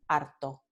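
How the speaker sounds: harto con vibrante simple, "aɾto"; Andalusian harto. Shown as a demonstration. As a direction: decir "harto" con una r suave de un toque. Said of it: "con la vibrante simple". ("harto" con vibrante simple)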